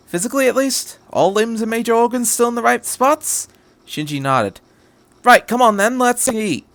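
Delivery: the Doctor. Acted. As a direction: play the clever Doctor, teasing the angel. Narrator voices the Doctor.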